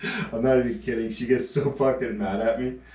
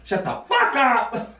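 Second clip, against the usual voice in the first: much higher, slow, laughing retort; mock angry snap; 11 semitones higher, slower than usual. Emma telling him to shut up, mock anger. Girlfriend snaps at his joke, retold.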